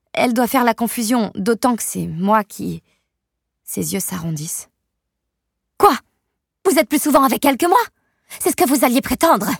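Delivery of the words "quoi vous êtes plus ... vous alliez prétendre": high, loud and fast accusing rant; jealous mother shouting at the babysitter; angry outburst. Acted. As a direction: fire it at her, furious. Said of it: "Crie Amber".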